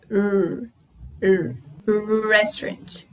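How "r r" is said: R with a slightly closed mouth; R with the tongue curled back; R with mouth nearly closed. Shown as a demonstration. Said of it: "Fecha um pouquinho a boca".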